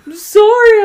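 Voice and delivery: high, loud mimicked apology; called-back apology. Imitating Emily answering the scolding, loud high apology. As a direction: shout sorry back in a mimicking voice.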